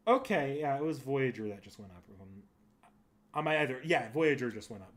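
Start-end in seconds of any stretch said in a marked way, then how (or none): none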